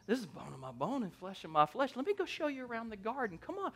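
soft, eager aside rising in pitch; hushed, playful courting; smitten Adam. Acted. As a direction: sweet-talk her quietly, quickening. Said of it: "you gotta picture this in your mind".

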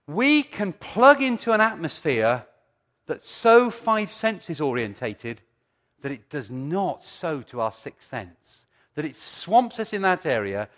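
loud, slow forceful preaching. Acted.

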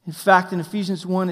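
usual speaking voice

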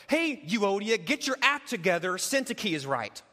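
a blunt rebuke, loud; mock scolding. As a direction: snap at her to shape up. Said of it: "what he doesn't do is go".

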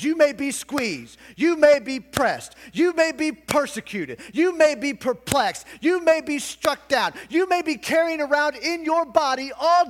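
high, loud, driving sermon delivery; full-force preaching. Acted. Pastor preaching Romans to his congregation, full force.

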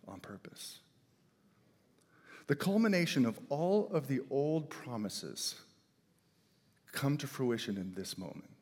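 ordinary delivery

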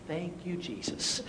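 very soft, slow thanks; hushed relief. Retold or imitated. Youth director just forgiven, hushed and relieved. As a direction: breathe out a quiet prayer of relief.

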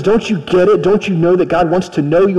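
ordinary delivery

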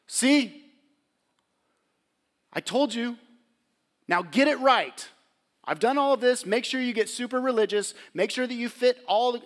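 mock scolding Jesus. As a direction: play a nagging religious scold. Mock harsh Jesus, rejected.

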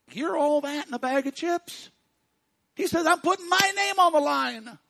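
1 high voice of God. Acted. Preacher voicing God loudly.

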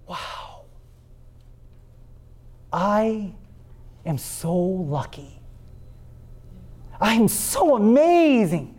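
breathy wow, then a slow, self-admiring brag; mock awe. Preacher voicing an astronaut in space, mock self-awed. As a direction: marvel at yourself floating in space. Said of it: "the words that come out of your mouth".

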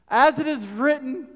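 loud, high preaching to the congregation; full-force preaching. Acted. Preacher pressing the point on faith, full force. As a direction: drive it home from the pulpit.

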